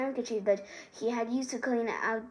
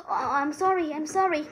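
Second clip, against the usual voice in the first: anxious apology; 5 semitones higher, 4 dB louder than usual. A narrator's reading. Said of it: "Hermione cried".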